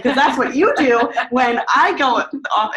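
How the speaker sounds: high, loud sarcastic outburst; furious sarcastic shout at husband; going off. Retold or imitated. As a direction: throw his behaviour back at him, loud. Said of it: "And I went off".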